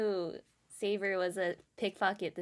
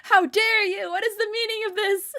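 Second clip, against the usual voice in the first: high, loud indignant judge; scandalized courtroom outburst; outraged shout; 12 dB louder than usual. Acted. Acting outraged judge after shot.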